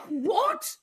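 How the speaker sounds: sudden very high squeak of surprise; a kid who can't believe letters stand for sounds; amazed child. Retold or imitated. Teacher voicing a child's astonishment at the letter B, very high.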